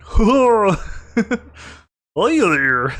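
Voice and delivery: loud, put-on creature voice; booming, drawn-out hello; creature's greeting. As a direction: play a goofy creature greeting someone. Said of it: "The one on the left looks like".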